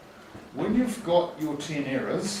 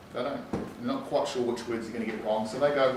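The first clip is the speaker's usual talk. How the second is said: ordinary talk, nothing marked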